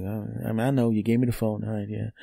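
embarrassed mumble; awkward reply. Retold or imitated. To her at the door, softly and awkwardly. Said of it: "And I'm like".

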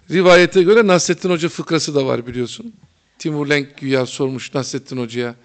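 ordinary talk, nothing marked

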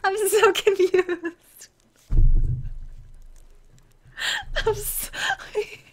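slow, giggling confusion; real confused giggling; laughing. Real, not acted. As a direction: laugh through the admission. Giggling confusion about names.

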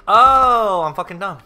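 exasperated exclamation. Real, not acted. Realizes own mistake, exclaims.

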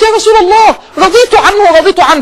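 high, loud outcry of forgiveness; urgent pleading mother. Acted. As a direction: act a mother relenting in alarm. Mother relenting as son faces fire.

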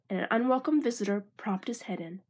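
plain reading voice

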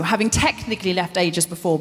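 ordinary talk, nothing marked

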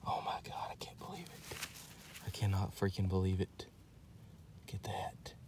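hushed, breath-only disbelief; awed whisper. Real, not acted. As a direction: whisper it, stunned by the find. Overwhelmed by the find.

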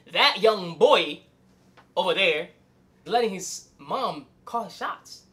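drawn-out, pointed words; slow emphasis. Real, not acted. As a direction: spell it out slowly, scornfully.

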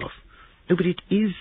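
high, impish retort; gleeful young man. Acted. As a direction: delight in provoking them, pitched high. Christopher gleefully defends his joke.

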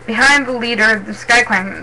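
plain reading voice

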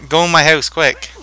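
urgent loud call to shelter; being chased. Real, not acted. Chased by zombies.

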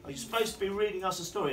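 put-on high, loud child's wake-up call; imitating his children. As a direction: yell it like kids rousing a parent. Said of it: "my children would nudge me and go".

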